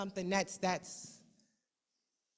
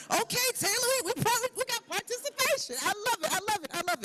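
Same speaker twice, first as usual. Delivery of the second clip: high, delighted mock exclamation; 高声欢快地模仿自己的反应; excited cheer; 13 semitones higher, faster than usual. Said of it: "I'm like".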